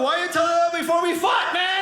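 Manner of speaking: high-pitched exasperated yell; indignant protest. Acted. Losing fighter to winner after the choke, indignant protest.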